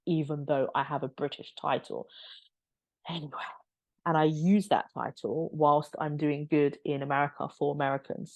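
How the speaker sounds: normal talking voice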